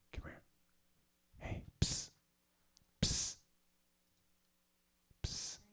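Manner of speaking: hushed psst to get attention; whispered psst. Real, not acted. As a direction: hiss it quietly across the room. Calling softly to girlfriend.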